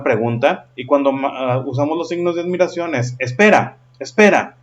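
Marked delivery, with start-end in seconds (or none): 3.2-4.6 "Espera, espera" short, clipped and louder, second one higher; exclamation. Shown as a demonstration. Teacher demonstrating an exclamation to the class, loud and short. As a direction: snap it out, short and firm. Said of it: "Como que más conciso, más corto".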